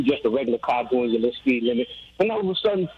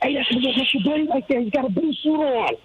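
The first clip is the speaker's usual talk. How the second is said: high, excited mock sister voice; imitating his sister; 12 semitones higher than usual. Caller imitates his twin sister spotting the host at a game, high mock voice. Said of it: "She's like".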